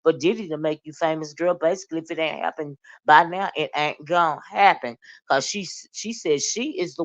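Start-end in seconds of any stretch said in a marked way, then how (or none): 0.0-5.0 rapid sassy read. Retold or imitated. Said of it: "She said".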